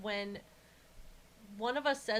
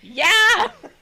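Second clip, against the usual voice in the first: loud, high, laughing yeah; excited yell; 12 semitones higher, 20 dB louder than usual. Retold or imitated. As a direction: shout it gleefully through a laugh. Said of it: "And I went".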